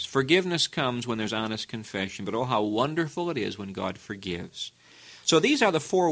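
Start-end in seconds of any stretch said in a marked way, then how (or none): none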